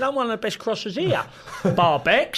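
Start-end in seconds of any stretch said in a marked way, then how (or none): 0.0-1.2 higher, boastful claim; lightly voiced cocky teammate. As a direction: brag like a show-off. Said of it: "He said".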